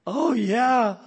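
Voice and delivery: teasing disbelief; mocking. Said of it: "making a mockery of it".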